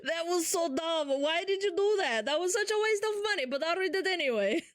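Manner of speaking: high, hammed-up generic European accent. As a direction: imitate an exasperated streamer with a Hungarian accent. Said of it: "European accent".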